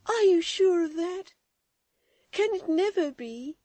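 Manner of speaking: high, loud distressed cry. Acted. Florence seeing her mother weep. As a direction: call out to her weeping mother.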